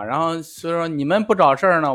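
ordinary talk, nothing marked

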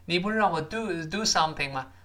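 ordinary talk

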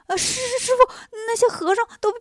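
high, panicky stammer; scared novice blurting bad news; startled, frightened. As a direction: stammer it out to your master in a high, loud fright. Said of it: "吓了一跳".

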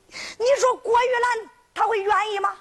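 ordinary delivery, nothing marked

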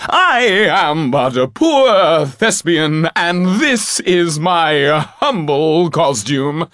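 very high, loud, slow theatrical plea; a hammy thespian begging grandly for mercy; captured actor pleading with the robbers, overblown; pompous actor pleading. Acted. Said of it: "cried the gentleman".

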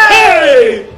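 a loud bragging 嘿; proud shout. Acted. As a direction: call out to draw the crowd. Innkeeper boasts his name. (得意地大喊一声)

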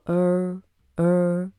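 '儿' as a long American 'er'; r-coloured vowel. Shown as a demonstration. Teacher blending vowel and R for learners, drawn-out 'er'. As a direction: curl the tongue and hold '儿', twice. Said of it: "所以连在一起就读作".

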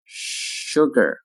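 "Sugar" with a long held sh; slow careful "Sugar"; drawn-out model. Shown as a demonstration. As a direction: stretch "Sugar" out for learners. Teacher models word slowly, then normally.

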